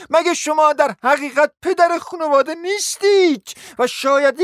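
high, loud, outraged tirade; indignant lady scolding. Acted. Wife berating her husband before the guests, shrill with outrage. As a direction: tear into him in a high, furious voice.

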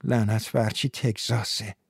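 quiet aside to himself; muttered curse. A narrator's reading. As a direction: grumble it under your breath. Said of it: "جانی زیر لب گفت".

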